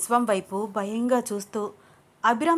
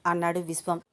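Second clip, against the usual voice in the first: plain reading voice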